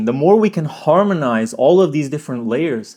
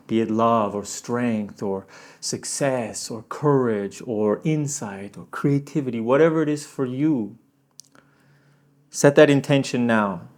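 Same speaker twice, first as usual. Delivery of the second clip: guided meditation; 4 semitones lower, 4 dB quieter than usual. Acted. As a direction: gently lead them inward. Guides intention setting.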